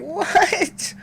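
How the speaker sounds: very high squeaked what; can't believe it; mock outraged what. Son reacts in high disbelief to his punishment.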